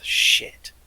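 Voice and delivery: unvoiced muttered curse; whispery in-character mutter; under his breath. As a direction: swear to yourself under your breath. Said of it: "under his breath".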